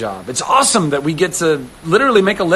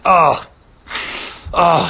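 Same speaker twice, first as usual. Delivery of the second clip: ordinary talk, nothing marked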